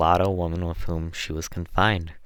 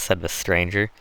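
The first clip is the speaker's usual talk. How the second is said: ordinary delivery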